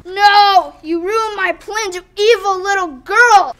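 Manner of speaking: loud furious yell; angry villain shout. Acted. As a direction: yell the line in rage.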